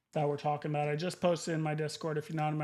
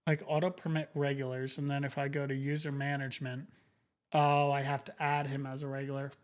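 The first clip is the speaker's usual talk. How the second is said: ordinary talk, nothing marked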